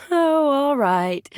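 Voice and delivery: high, laughed-out "oh" of giving in; laughing surrender. Acted. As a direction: give in with a little laugh. Gives in with a laugh.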